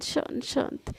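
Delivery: whispered, soft hush. Acted. Friend calms furious Sana, whispering. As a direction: breathe the words to calm her. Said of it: "अरे मेरी माँ, शांत".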